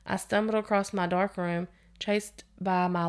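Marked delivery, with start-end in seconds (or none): none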